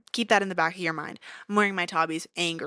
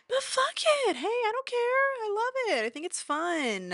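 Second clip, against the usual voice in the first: carefree squeal; 14 semitones higher than usual. Real, not acted. Carefree high burst of defiance.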